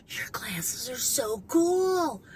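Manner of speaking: slow, drawn-out admiring gush; imitated awe. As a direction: draw it out like the wowed girl. Retelling girl admiring her sunglasses.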